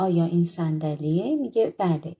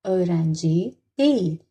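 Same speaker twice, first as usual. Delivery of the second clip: öğrenci değil very slow, falling on değil; slow model answer; much slower than usual. Shown as a demonstration. Teacher models the negative answer, slow and falling. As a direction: say öğrenci değil slowly and let değil fall.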